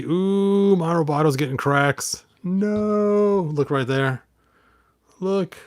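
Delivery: high, alarmed ooh; dismayed at the cracks; surprised. Real, not acted. Spots cracks on the toy.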